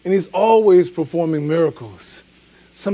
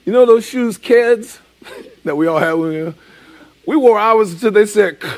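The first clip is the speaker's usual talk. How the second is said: Fred Sanford impression; 4 semitones higher, 5 dB louder than usual. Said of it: "He said".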